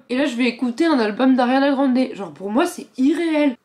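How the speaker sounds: excited. Real, not acted. Fan excitement over album.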